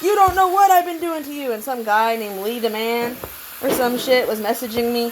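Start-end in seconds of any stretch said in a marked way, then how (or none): 0.0-1.5 high, mocking taunt; imitating a menacing taunt; mocking threat. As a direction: mimic the threatening messages in a high, sneering voice. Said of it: "they kept saying".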